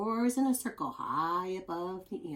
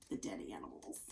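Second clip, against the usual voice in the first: grossed-out whisper; 14 semitones lower, 14 dB quieter, faster than usual. Acted. Reading gross fact, dropping voice.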